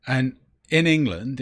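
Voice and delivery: normal talking voice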